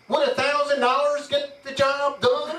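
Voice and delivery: slow, high Texan drawl asking; lightly put-on Texas accent, amused. Rich Texan to the maitre d', in a Texas accent. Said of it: "in his Texas accent".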